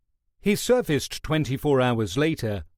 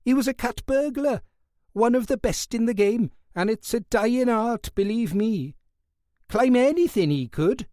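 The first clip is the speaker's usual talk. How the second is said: higher, quicker Welsh guard bragging about a boxer; high Welsh voice, enthusiastic; 10 semitones higher than usual. Acted. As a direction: let the Welsh fan's pride spill over.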